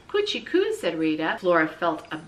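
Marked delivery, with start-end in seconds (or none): 0.1-0.7 put-on high cooing baby talk. A narrator's reading. Rita coos high at the squirrel in the shoebox.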